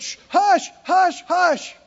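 high, hushing the wailing crowd. Retold or imitated. Caleb hushing the frightened crowd, high and urgent. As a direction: shush them like Caleb calming people. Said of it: "Caleb and Joshua got up and said".

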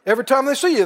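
ordinary talk

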